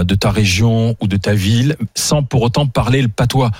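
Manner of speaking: usual speaking voice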